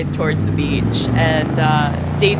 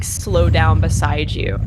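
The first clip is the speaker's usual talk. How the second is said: ordinary talk, nothing marked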